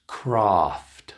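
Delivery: "Craft" said crahft; long ah. Shown as a demonstration. Teacher models word for practice, long ah in "Craft". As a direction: stretch "Craft" with an open ah. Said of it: "It's the long ah sound".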